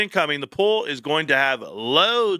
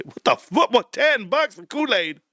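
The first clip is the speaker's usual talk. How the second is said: retold indignant disbelief, pitched up; mock outrage; 6 semitones higher than usual. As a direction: balk at the price in mock shock. Retold shock at drink price.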